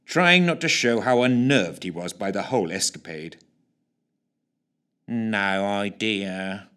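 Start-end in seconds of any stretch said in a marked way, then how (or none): 5.1-6.7 very slow lazy drawl. Acted. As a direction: stretch the words out lazily. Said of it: "drawled Snook".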